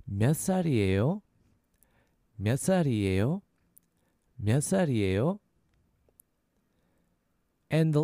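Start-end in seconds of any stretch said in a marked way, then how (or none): 0.0-1.2 몇 살이에요 joined up, rising at the end; a little faster. Shown as a demonstration. Teacher asking "how old are you", a little faster. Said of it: "A little faster, that's". 2.4-5.4 몇 살이에요 joined up, rising at the end; a little faster. Shown as a demonstration. Teacher repeating "how old are you", a little faster. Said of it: "A little faster".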